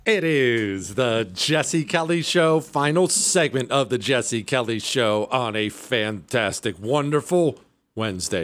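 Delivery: drawn-out radio show opening; show announcer. Acted. Host welcoming listeners to the final segment, drawn-out announcer delivery. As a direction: stretch the show title like an announcer.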